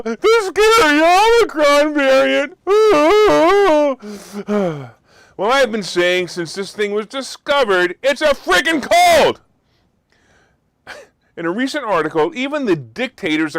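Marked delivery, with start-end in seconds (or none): 0.0-2.6 high, loud, slow spooky sarcasm; sarcastic horror-movie tone, much higher and louder; mock scary voice. Mocking media fear of Omicron. 2.6-4.9 big theatrical sigh, loud and high; loud mock-weary sigh; exaggerated loud sigh. Exasperated at Omicron hype. 8.0-9.4 much higher and louder, slow, stressing "freaking cold"; loud slow exasperated exclamation; exasperated outburst. Real, not acted. Ranting that Omicron is a cold.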